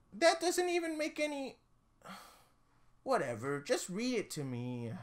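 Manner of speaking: put-on high, soft nervous child; high, soft child asking him to stop; uneasy kid voice. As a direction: squeak it like a weirded-out kid.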